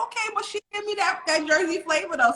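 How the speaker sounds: high, fast, excited retelling; retelling the surprise, high and quick; excited high quote. Retold or imitated. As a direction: squeal it out, amazed. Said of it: "I was like".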